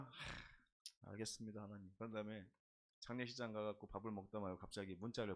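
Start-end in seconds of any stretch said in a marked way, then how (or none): 0.0-1.9 a sigh, then a soft, defeated yes to God; resigned sigh. Retold or imitated. Preacher answering God's rebuke, quiet and resigned. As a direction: give in quietly after a sigh. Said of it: "그래서 제가".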